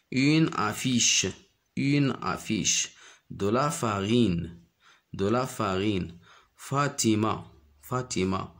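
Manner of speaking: slow, clear teacher reading; slow model reading. Shown as a demonstration. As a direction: read each word slowly for the class. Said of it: "teacher models words slowly".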